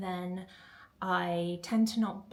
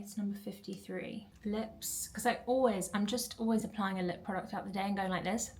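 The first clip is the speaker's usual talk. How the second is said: ordinary delivery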